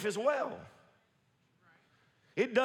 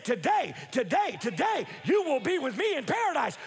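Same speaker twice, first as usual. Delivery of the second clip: proclaiming shout; 5 semitones higher than usual. Retold or imitated. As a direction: call it out like a promise that cannot fail. Jesus voiced, shouting promise.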